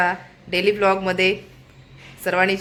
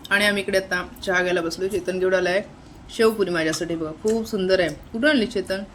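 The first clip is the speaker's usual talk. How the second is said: ordinary talk, nothing marked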